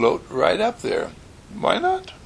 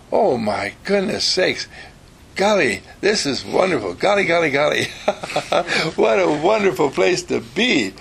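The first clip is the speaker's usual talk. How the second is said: delighted wonder; 6 semitones higher, 3 dB louder than usual. Retold or imitated. Child marvels at the North Pole.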